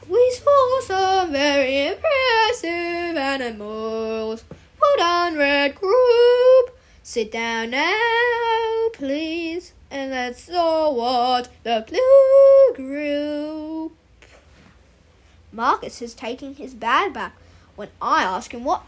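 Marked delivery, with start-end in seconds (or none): 0.1-6.8 very drawn-out, singsong drama teacher; theatrical drama teacher. Acted. Drama teacher setting the giant warm-up, theatrical singsong. 7.0-9.7 high singsong, very slow and stretched; theatrical drama teacher. Acted. Drama teacher seating the red group, theatrical singsong. 9.9-14.0 very drawn-out, singsong drama teacher; theatrical drama teacher. Acted. Drama teacher setting the giant warm-up, theatrical singsong.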